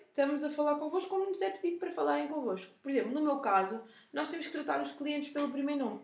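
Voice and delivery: ordinary talk, nothing marked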